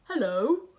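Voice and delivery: a hint of a gruff bear greeting; gruff grisly voice. Polar bear greeting Nora, lightly gruff. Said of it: "says a gruff, grisly voice".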